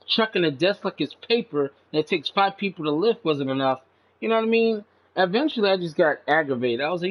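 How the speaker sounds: ordinary talk, nothing marked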